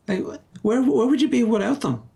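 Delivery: high, mock-distraught wail; mock sadness. Pitying a gamer's life without video games, mock sad. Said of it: "It's making me sad".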